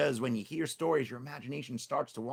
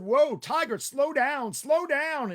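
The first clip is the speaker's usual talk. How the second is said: higher, louder panicky whoa, slow down; alarmed cry; 12 semitones higher, 7 dB louder, slower than usual. Acted. Tricky nearly falling off, calling to Tiger to slow.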